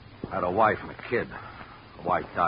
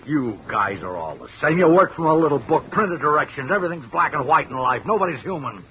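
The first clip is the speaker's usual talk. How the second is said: louder and higher, resentful ranting; heated, raised-voice complaint; bitter tirade; 6 semitones higher, 5 dB louder than usual. Acted. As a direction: lash out at the rule-book man. Watchman rails at the investigator.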